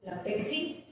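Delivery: "Pepsi" said softly as peksi; "Pepsi" with a ks cluster, pek-si; mispronounced Pepsi. Shown as a demonstration. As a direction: swap the middle p of "Pepsi" for a k. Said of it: "la falta de dicción".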